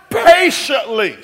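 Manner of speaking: "Patiently" shouted slowly; shouted answer. Shown as a demonstration. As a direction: roar out "Patiently", stretched. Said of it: "but it says wait how?".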